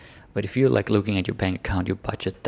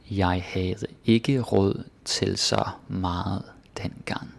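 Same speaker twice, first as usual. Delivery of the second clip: slow whispered read; slower than usual. Shown as a demonstration. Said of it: "reads example sentence slowly".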